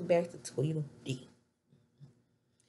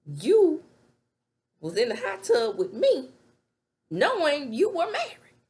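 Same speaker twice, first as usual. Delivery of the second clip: much higher and louder accusing shout; mock furious yell; 10 semitones higher, 8 dB louder than usual. Wife yelling at Nate about the hot tub, hammed-up furious shout. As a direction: shout him down with the blame.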